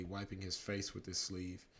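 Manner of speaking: plain reading voice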